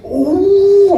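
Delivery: high, loud frustrated "ooh"; rising-pressure howl of exasperation; exasperated ooh. Retold or imitated. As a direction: let out a high stressed wail. Driver melting down in retold stress.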